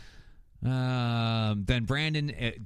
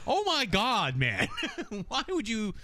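amused disbelief; 8 semitones higher than usual. Real, not acted. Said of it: "I'm like".

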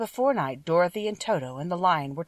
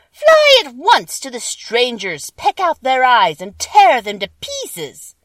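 loud, slow, higher witch voice; wicked witch command; 7 semitones higher, 11 dB louder, slower than usual. A narrator's reading. Witch sending the crows, loud and slow. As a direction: spit the order like a wicked witch.

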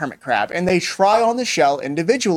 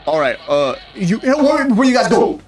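confused driver voice; 6 semitones higher than usual. Acted.

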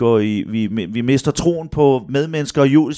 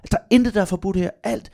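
higher-pitched reassuring voice; imitated therapist; 6 semitones higher than usual. Therapist inviting boy to speak freely, high and encouraging. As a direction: imitate a therapist giving full permission. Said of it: "Og terapeuten siger".

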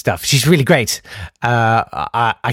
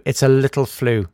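ordinary talk

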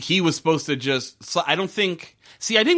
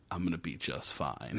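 smug quiet line; 9 semitones lower, 15 dB quieter than usual. Retold or imitated. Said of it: "And he's like".

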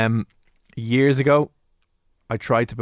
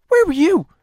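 high sharp call of where were you; loud retold question; 8 dB louder than usual. Friends confront a late classmate, loud and shrill. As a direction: friends demanding where he'd been.

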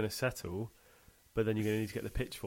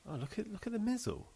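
higher lightly mocking voice; posh mock voice; 13 semitones higher than usual. Mimicking a pretentious friend to the co-host, high mockery. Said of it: "And he was like".

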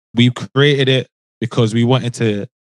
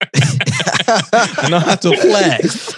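laughing; 7 semitones higher, much slower than usual. Real, not acted. Slow laughing boast.

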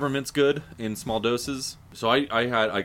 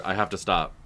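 normal talking voice

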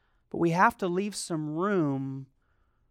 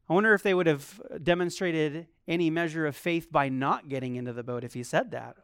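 ordinary delivery

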